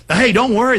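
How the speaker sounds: ordinary talk, nothing marked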